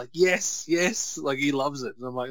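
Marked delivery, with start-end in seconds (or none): 0.1-1.2 put-on excited kid. Son celebrating Brock's F5 on Cena, higher cheer. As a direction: cheer like a delighted boy.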